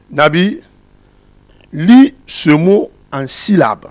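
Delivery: put-on commands the child loudly and slowly; a teacher's slow, loud order to a pupil; consigne de maître. Shown as a demonstration. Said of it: "l'élève face à un mot, comment il doit lire". (consigne lente et forte du maître)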